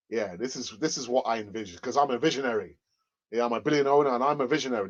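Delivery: lightly voiced billionaire owner, pitched low; a hint of a cigar-smoking boss, low; Stan Kroenke. Owner boasting in a secret meeting, lightly acted low voice. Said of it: "Stan Kroenke, you know, smoking his cigar".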